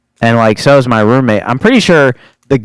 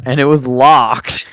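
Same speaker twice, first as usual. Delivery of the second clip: normal talking voice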